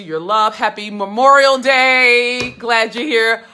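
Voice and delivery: ordinary talk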